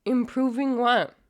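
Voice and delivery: high, loud retort; incredulous challenge. Real, not acted. Scoffing back at the played clip.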